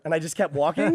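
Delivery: ordinary delivery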